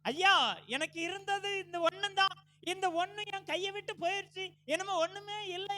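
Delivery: high, loud anguished plea; acted mother's grief, pitched high; tearful lament. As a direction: cry out as a bereft, disappointed mother. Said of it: "ஏக்கத்தோடு கூட, ஏமாற்றத்தோடு கூட, கண்ணீரோடு கூட".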